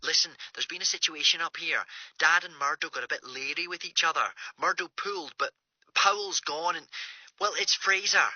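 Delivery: small, faraway voice down a phone line; Greer on the phone. Acted.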